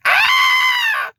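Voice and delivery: shrieked "aaah", far above speaking pitch; high scream. Acted. Gunman screaming as he is thrown, high shriek. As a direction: scream as if hurled through the air. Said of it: "sound of someone being thrown and screaming".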